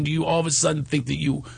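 ordinary talk, nothing marked

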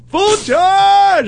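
high shouted nickname; mock shout of a nickname at a passer-by; yelled from a car. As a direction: holler it from a passing car. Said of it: "I'll be running".

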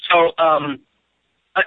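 ordinary talk

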